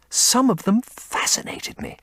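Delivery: breathy secret whisper; hushed confession. Acted. Dorian recalls secret fascination.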